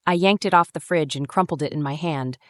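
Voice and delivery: ordinary delivery, nothing marked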